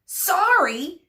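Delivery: high, incredulous rising questions; screamed. A narrator's reading. Hairdresser rejects Medusa's apology, screamed in outrage. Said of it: "screamed Monsieur Joseph".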